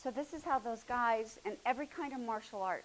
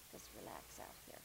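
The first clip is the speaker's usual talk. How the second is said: soft, barely voiced, lower; whispered cue; 13 semitones lower, 15 dB quieter than usual. Real, not acted. Teacher whispering to her student to relax. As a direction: whisper it gently so she lets go.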